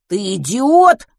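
loud enraged roar; angry yell. A narrator's reading. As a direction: bellow back at him. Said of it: "заорал в ответ Матвей".

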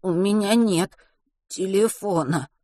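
bleating, cracking high voice; breaking voice. Acted. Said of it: "проблеял срывающимся голосом".

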